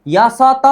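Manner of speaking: यासत with an extra open "aa" on the end; यासत ending in a full vowel; wrong pronunciation. Shown as a demonstration. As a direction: sound out यासत as three syllables, ya-sa-taa. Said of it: "नहीं".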